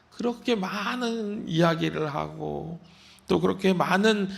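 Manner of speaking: normal talking voice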